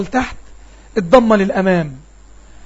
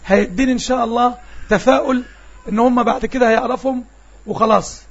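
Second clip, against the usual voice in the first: ordinary talk, nothing marked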